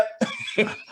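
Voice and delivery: high unvoiced laugh; breathy laugh. Real, not acted. He laughs at his own story with a high unvoiced laugh.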